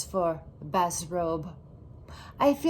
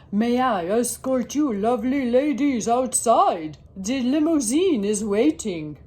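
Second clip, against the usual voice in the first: lightly voiced posh dad, a little louder; posh gentleman; 4 dB louder than usual. Dad inviting the ladies to his limousine, lightly posh. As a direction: play the grand escort. Dad playing fancy chauffeur.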